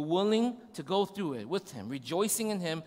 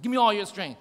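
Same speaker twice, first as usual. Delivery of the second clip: louder, higher imitated demand; insistent repeat; 4 semitones higher, 9 dB louder than usual. Son demanding dad's full effort, louder and insistent. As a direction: push the demand harder, as a determined kid. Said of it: "He's like".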